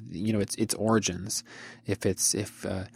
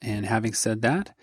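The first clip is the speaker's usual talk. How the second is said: usual speaking voice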